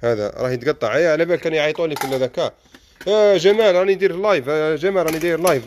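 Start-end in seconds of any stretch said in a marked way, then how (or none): 3.1-4.5 loud high call across the shop; hailing a friend from afar. Real, not acted. Calling to Jamal across shop. 4.5-5.7 a repeat hail, still raised; repeated call. Real, not acted.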